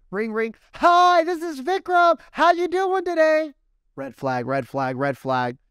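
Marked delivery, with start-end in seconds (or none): 0.1-0.6 phone ring. Retold or imitated. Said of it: "Don't be excited". 0.7-3.5 much higher, much louder, overeager phone greeting; overexcited telemarketer. Acted. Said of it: "Every telemarketer says that".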